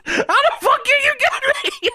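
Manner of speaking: very high falsetto squeal; Paul Bearer. Acted. Wrestling manager berates useless Kane, high mock squeal. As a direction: whine it in comic exasperation. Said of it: "Paul Bearer says".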